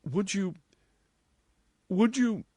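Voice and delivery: high, halting false starts; plead, stopping after each attempt; appealing. A narrator's reading. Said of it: "she turned to John Dene appealingly".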